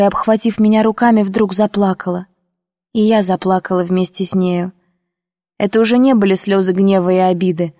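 usual speaking voice

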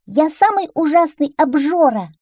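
high, loud duckling voice; boastful little duckling. Acted. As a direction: announce yourself proudly as a cocky duckling. (хвастливый утёнок, высоко и громко)

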